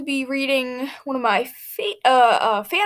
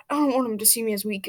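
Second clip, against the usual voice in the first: ordinary delivery, nothing marked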